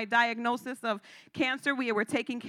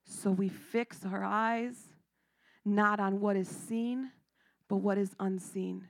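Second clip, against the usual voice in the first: hushed scripture; 3 semitones lower, 4 dB quieter than usual. Acted. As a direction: let the verse land gently.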